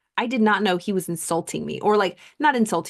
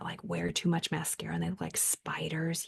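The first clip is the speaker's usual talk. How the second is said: much softer, breathy whisper; whispered gossip; 11 dB quieter than usual. Retold or imitated. Older woman hinting about her mascara, whispered. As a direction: whisper it like a catty secret.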